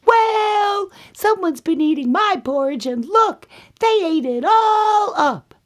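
very high, loud little-bear squeal; upset Baby Bear. Acted. Baby Bear finds his bowl empty, high outraged squeal. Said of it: "And then Baby Bear says".

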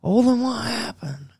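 high, slow mock wife voice. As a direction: ham up his wife's exasperated wail. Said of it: "And she's like".

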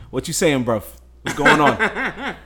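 London street accent, hammed up and laughing; a badly faked London roadman accent; mock British slang. Host greeting guest in mock London slang, laughing. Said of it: "in the words of some British hoodlums".

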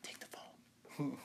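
slow, loud pleading that drops to a whisper; pushy salesman. Pretend. Pushing a free phone on Mariah, pleading then whispering. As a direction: beg her to take the phone, then whisper it. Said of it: "she thinks she's too good for my phone".

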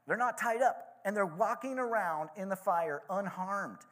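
high, excited shout, loudest on unbound. A narrator's reading. King shouting about four men in the fire, amazed. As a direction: cry out in wonder at what he sees. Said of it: "Nebuchadnezzar shouted".

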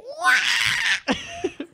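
high squealing droid beep-whistle. Pretend. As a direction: shriek out a beeping little robot. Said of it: "give me your best R two impression".

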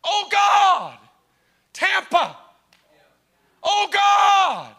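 loud, high prayer shout; shouted intercession for Tampa; crying out to God. Real, not acted. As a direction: cry out for the city.

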